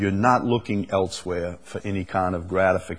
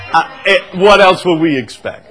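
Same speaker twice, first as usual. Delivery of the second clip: laughing; 8 semitones higher, 6 dB louder than usual. Real, not acted. Laughing at breast remark.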